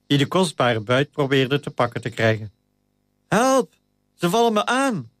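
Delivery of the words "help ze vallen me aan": panicked cry for help. A narrator's reading. Titus calls for help as rats attack him, high panicked cry. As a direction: yell for help as rats swarm you. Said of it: "riep hij".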